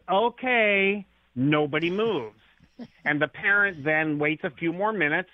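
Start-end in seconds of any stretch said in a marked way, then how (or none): 0.1-1.0 higher-pitched mock child's okay; lightly voiced child giving in; child's reply. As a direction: answer as the kid, pitched up. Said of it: "Child says".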